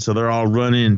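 ordinary talk

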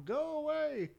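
imitated distant yell. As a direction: imitate a mother calling from the back room. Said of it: "his mother yells from, like, a back room".

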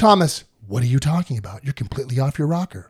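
confused Thomas. Retold or imitated. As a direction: mumble it like a baffled doubter. Thomas voiced again, low.

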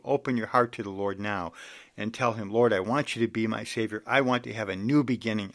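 usual speaking voice

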